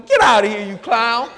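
loud, scoffing dismissal; exasperated father. Acted. Father to a child talking phony, exasperated. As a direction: throw him out with a loud, mock-angry burst.

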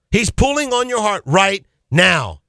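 slow, with "right" and "now" set apart; emphatic repeat. Real, not acted. Preacher repeats the appeal to listeners, slow and weighty. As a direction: draw out "pulling on your heart right now".

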